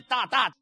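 two loud, punched bang noises; imitated gunshots. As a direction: fire off two sharp vocal shots. (imitando dos disparos, fuerte)

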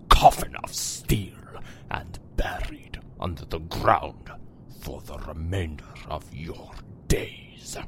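menacing whisper. Acted. Master threatens his minions.

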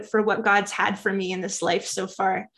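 ordinary delivery

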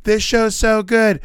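high, slow gushing fan voice; a hammed-up impression of gushing fans; mocking fans. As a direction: parody the fans gushing over it. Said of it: "I know everybody's like".

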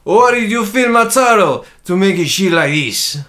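loud, high, slow Italian accent; hammed-up Italian exclamation. Shown as a demonstration. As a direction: declaim it like a stage Italian.

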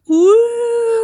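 high, loud hooting cry; Lucy Smith noise. Pretend. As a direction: hoot like a silly creature. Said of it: "What does a Lucy Smith sound like".

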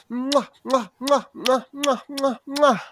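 high smacking kisses; shower the baby with kisses; blowing an air kiss. Pretend. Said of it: "just before I grab you".

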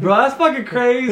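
high, loud, real disbelief; astonished outburst. Real, not acted. Friend reacts to wild hookup story, astonished outburst. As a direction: exclaim loudly, pitch shooting up.

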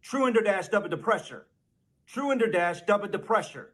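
Truender dash dubba depressure rattled off quickly. Shown as a demonstration. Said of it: "Say it a little more quickly".

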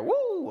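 very high shepherd whoop; imitating a shepherd's call, high whoop; Ric Flair woo. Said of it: "like a Ric Flair".

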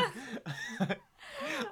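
high loud burst of laughter. Real, not acted. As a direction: crack up completely.

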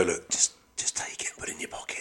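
hushed whisper, barely voiced. To a good waiter, slipping him a tip in a whisper. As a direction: slip it to them in a secret whisper. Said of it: "Don't mind whispering".

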